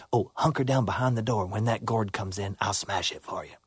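low, soft, sly fox voice. Acted. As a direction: murmur it like a crafty fox.

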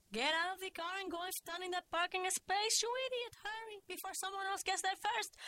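put-on high-pitched, accented, urgent scolding; bossy Cuban mother. A narrator's reading. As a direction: order your daughter out, exasperated. Mother bossing daughter to grab space.

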